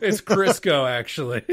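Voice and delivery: laughing through a quick retort; a loud laughing correction. Real, not acted.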